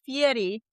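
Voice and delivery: Fieri with a tapped R, nearly a D; rolled R. Real, not acted. Friend insisting on the name Fieri, rolled R. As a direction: roll the R in Fieri so it sounds close to a T. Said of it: "I'm rolling my R".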